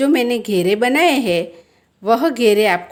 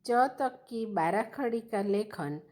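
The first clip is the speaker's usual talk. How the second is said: usual speaking voice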